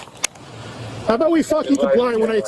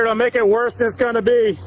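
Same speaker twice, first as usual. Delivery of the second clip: usual speaking voice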